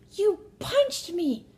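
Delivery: very high, loud and drawn-out; mock wailing accusation. Son accusing his sister of punching him, mock wail. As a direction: wail it like an aggrieved little brother.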